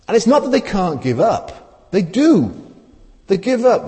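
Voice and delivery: high, loud, insistent; emphatic preaching. Real, not acted. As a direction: drive the point home high and loud. Emphatic sermon point.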